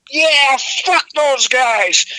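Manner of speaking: drawn-out, high-pitched mock crowd cheer; imitated rowdy fan yell; crowd going nuts. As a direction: cheer it slowly like a riled-up arena. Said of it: "they went nuts, like, positive".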